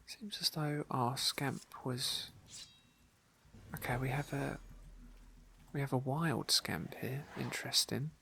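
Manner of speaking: usual speaking voice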